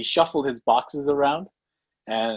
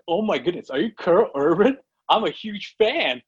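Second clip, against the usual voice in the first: higher-pitched gushing fan, not truly shouted; excited fan yell; 6 semitones higher than usual. Retold or imitated. Retelling how he met an actor down the hall, excited mock yell. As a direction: re-enact a starstruck outburst, pitch climbing. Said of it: "I yelled out, out of excitement".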